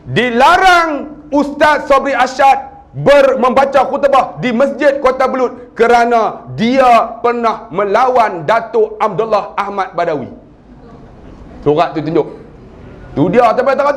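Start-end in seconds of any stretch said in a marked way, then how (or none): 0.1-10.5 slow, emphatic proclamation, each phrase drawn out; official letter read-out. Acted. Preacher reads a banning letter to the congregation, slow official proclamation. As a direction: read the ban out like a formal decree. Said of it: "Sampai surat".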